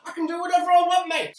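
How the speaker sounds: gleeful boast. Acted. Boasting to the audience as self-appointed judge, cheeky and loud. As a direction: crow it, full of yourself.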